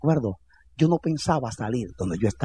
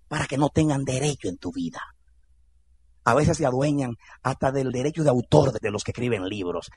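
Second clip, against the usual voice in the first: ordinary delivery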